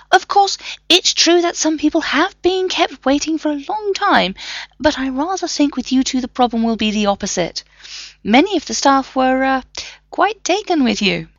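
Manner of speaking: high, coy professor voice; a sly, delighted academic; fussy professor. A narrator's reading. Professor hinting staff fancy the men, coy and high.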